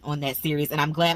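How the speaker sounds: usual speaking voice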